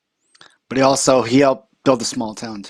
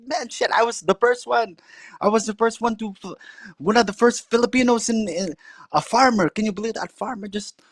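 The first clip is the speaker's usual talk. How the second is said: indignant uncle; 8 semitones higher than usual. Retold or imitated. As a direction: complain in the uncle's high voice. Uncle voiced in story.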